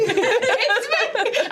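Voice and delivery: high laughing imitation; laughing squeal.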